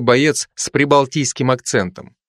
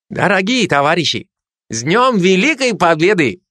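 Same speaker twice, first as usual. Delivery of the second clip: put-on higher festive Kazakh-accented toast; 6 semitones higher than usual. Kazakh soldier translating his toast, in a Kazakh accent. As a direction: proclaim it proudly in a Kazakh accent. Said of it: "каждый со своим акцентом".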